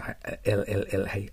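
ordinary talk

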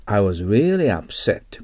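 slow model reading. Shown as a demonstration. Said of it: "model sentence read out".

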